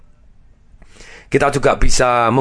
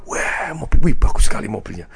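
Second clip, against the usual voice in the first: awestruck gasp at a fancy car; mock amazement. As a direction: imitate a wowed onlooker. Acting awe at a luxury car. (pura-pura takjub)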